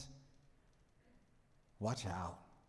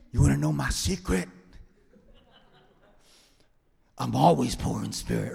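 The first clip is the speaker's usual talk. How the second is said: loud Banner-style quote; 3 semitones higher, 11 dB louder than usual. Acted.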